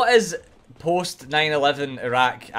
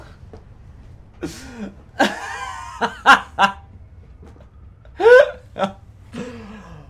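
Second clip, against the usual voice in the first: long laugh; 7 semitones higher, 4 dB louder than usual. Real, not acted. Laughing at the anticlimactic boss.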